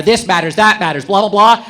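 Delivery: ordinary talk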